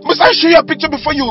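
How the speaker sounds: high, fast, heated question; angry repetition. Acted. Husband demanding wife just listen, rapid high anger. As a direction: rush it out in exasperation.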